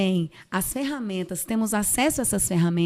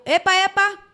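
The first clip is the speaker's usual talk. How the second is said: loud, high self-interruption; freada brusca; 9 semitones higher, 6 dB louder than usual. Retold or imitated. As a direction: catch yourself sharply mid-sentence. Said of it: "falei com uma entonação que eu nunca gostei". (alerta súbito, alto e agudo)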